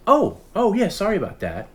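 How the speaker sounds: high, startled 'oh' into a quick apology; caught off guard. Retold or imitated. Scammer answering the buyer's callback, high and caught off guard. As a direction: imitate someone caught out, pitch jumping up.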